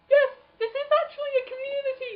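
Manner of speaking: American chap impression. About the YouTube community, a high gushing American impression. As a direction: gush like an earnest American. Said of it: "American chap who was like".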